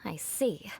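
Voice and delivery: usual speaking voice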